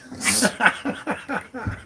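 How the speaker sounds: high-pitched run of laughter; cheeky laugh. Real, not acted. Host joking about an absent viewer, high laugh. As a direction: crack up at your own joke.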